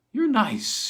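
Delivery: the singer purring at her visitor; purred. A narrator's reading. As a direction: tease him sweetly. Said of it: "she purred".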